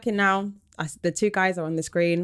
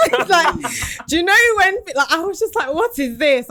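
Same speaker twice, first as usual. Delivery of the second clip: high and loud, laughing in disbelief; 11 semitones higher, 6 dB louder than usual. Real, not acted. Teller reliving the icebreaker snub, in high laughing disbelief. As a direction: squeal with outraged amusement.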